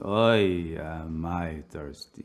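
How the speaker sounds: lightly voiced old man's slow lament; a hint of a muttering old grumbler; complaining old man. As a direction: draw it out as a mock-weary complaint. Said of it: "this guy just keeps going".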